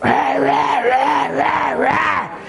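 high, loud screamo yell in mock; screamed hardcore growl. Pastor mimics the hardcore singer's scream, loud and high. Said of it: "he was like".

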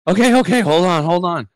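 high, loud mock panting plea; acted out-of-breath cry; winded son. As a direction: imitate a runner begging for a break. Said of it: "he's huffing and puffing".

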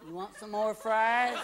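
put-on slow, drawn-out counter-boy voice; bored fast-food worker. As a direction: ask it like a sleepy fry cook. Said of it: "he's working at McDonald's going".

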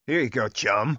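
loud menacing buddy voice. Acted. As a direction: bark it out as a fake friend. Riffing on killer friendly lines.

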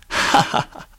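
breath-only staccato laugh; evil, voiceless ha-ha; dry, acted villain laugh; villainous chuckle. Villain laughs after recalling.

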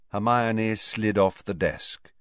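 ordinary delivery

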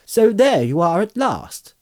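high, lively hobbit greeting; Merry. A narrator's reading. Merry greeting Frodo out of the fog, lively.

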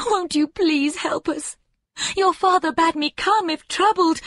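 a distraught mother near tears; tearful young mother. A narrator's reading. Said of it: "sobbing as though her heart would break".

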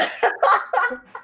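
high giggling laugh; high burst of laughter. Real, not acted. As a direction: breaks into giggles. Bursts out laughing at own story.

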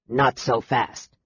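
low, slow dwarf voice. A narrator's reading. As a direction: stop them with a low, slow objection. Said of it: "stated the dwarf".

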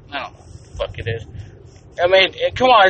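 normal talking voice

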